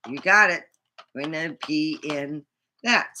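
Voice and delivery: normal talking voice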